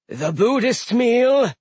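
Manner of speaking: loud, high slow call; calling chef. Acted. Eldir calling.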